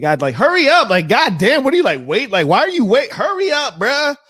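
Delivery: hammed-up squeal urging the doctor to hurry; impatient mock yell. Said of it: "and I was like".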